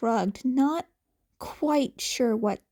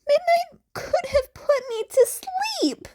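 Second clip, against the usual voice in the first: high whiny protest; faster than usual. Acted. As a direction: pitch up and whine it. Izuku protesting, voice breaking.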